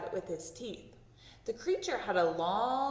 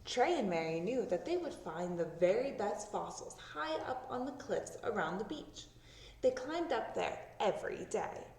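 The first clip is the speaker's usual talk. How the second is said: ordinary delivery, nothing marked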